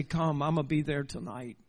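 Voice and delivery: a hushed, loving call; lover's call. Retold or imitated. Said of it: "heard the cry of your lover say".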